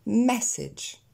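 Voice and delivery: "message" stressed on the first syllable, short second vowel; correct stress. Shown as a demonstration. Teacher correcting the double faute, correct pronunciation. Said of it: "Encore une fois".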